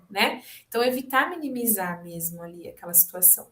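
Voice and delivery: ordinary delivery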